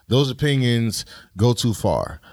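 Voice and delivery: ordinary talk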